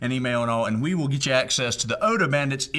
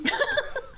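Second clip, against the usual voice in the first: high giggle; 4 dB quieter than usual. Real, not acted. Laughs at own pun.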